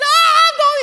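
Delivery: very high, loud imitated toddler wail; mock toddler wail; distraught toddler. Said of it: "He's distraught".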